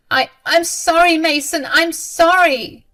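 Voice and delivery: very high, loud pleading; panicked apology. A narrator's reading. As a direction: beg forgiveness, stammering and drawing it out. Scared mother begs son's pardon.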